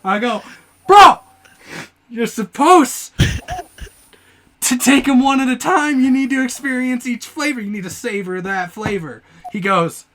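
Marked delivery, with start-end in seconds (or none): 0.9-9.2 loud, high "bro", then insistent lecturing; retold indignant scolding; exasperated protest. Retold argument over eating Skittles.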